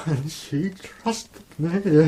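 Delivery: slow, loud broken grief; slow anguished outburst; anguished cry. Acted. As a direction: wail it out in guilt. Monk's grief breaks out.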